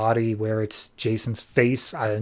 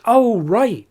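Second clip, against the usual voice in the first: high, louder dawning realization; sudden remembering; 10 semitones higher, 5 dB louder than usual. Retold or imitated. Stranger reminded of his forgotten promise, sudden realization. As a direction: jump up in pitch as it comes back.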